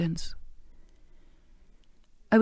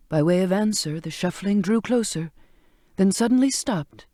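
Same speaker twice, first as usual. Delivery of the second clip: ordinary delivery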